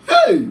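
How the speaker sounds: high-pitched cry of "hey"; exclaimed "hey". Real, not acted. Reacting to how the title names sound, a high exclamation. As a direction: let out a sudden, high "hey!".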